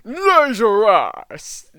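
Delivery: hammed-up attack call, stretched out; slow, loud, theatrical battle cry; exaggerated showboating fighter. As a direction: announce your special move with over-the-top drama. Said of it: "Like, he's exaggerated it".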